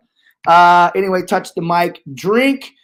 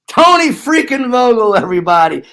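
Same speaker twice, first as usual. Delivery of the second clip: louder, higher announcer hype; hype intro shout; 5 semitones higher, 5 dB louder than usual. Real, not acted. Host hyping guest onto the show with a big intro. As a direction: bring the guest on like a ring announcer.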